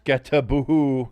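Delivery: slow, drawn-out Sketaboo; mock mispronunciation. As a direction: stretch Sketaboo slowly and loud. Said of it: "Pecan in the chat says".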